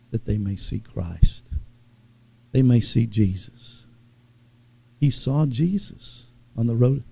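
low, soft reverent preaching; hushed preaching. Real, not acted. Preacher wishing people would see Christ, hushed preaching. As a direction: drop low and soft, as a wish.

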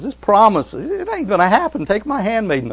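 a hint of a doubting wife; wife's voice.

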